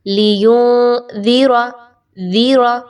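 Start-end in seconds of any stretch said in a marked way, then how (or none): 0.1-1.7 लियुनज़िरा slow, dal soft at the front teeth; the right way, gentle dal. Shown as a demonstration. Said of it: "दांतों के front वाले हिस्से के साथ". (लियुनज़िरा सही, दाल नरमी से)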